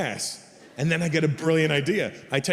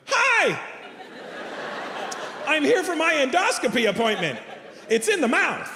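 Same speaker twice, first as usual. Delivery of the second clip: mock normal person; 11 semitones higher than usual. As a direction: overdo a cheery, normal receptionist-friendly voice. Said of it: "do my impression of how I think you would handle this situation".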